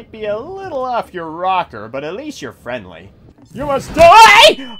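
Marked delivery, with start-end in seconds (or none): 3.5-4.8 high, loud, drawn-out threat; mock battle cry. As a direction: yell it, stretching out die. Attacking an enemy.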